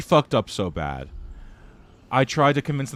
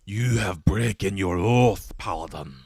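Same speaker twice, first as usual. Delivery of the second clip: Scottish paladin impression. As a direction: play the Scottish oathbreaker knight, lightly. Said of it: "who's got a Scottish accent".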